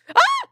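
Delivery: short, high, loud yelp; startled scream. Real, not acted. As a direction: yelp as if a spider just appeared. Startled reaction to spooky spiders.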